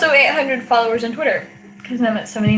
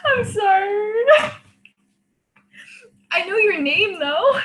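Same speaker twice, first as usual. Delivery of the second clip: laughing hysterically; 12 semitones higher than usual. Real, not acted. Drunk embarrassed laughing fit.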